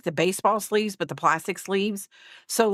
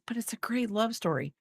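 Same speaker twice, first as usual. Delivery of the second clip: whispered, higher, much softer; sister's whisper; 5 semitones higher, 7 dB quieter than usual. Retold or imitated. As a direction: breathe it out like the dreamy sister. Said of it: "She's like".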